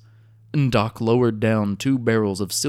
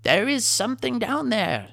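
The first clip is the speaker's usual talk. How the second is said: much higher, ominous lizard voice; dark kobold warning; 13 semitones higher than usual. A narrator's reading. Kobold chief warning of the evil below, high and ominous. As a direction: warn them like a frightened seer. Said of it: "said Ndok darkly".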